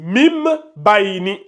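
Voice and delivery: "Mim bayni" slow with a long m; qalb pronunciation. Shown as a demonstration. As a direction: linger on "Mim" before "bayni". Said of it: "comme ce qui est écrit ici". (« Mim bayni » lentement, m bien tenu)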